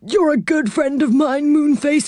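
put-on high, kindly old saucepan man; Saucepan's voice. Saucepan's kind offer.

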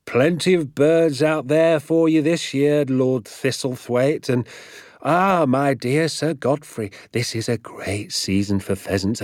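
mildly mocking a peacock-proud landowner; pompous host. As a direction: lightly ham up a self-important host. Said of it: "saying things like".

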